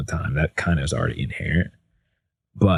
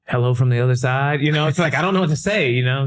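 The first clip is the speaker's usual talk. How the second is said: rising high, mock-helpless delivery; awkward floundering; 7 semitones higher than usual. Speaker stuck introducing himself on Zoom, high and flustered. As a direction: act out being lost for words, pitched up.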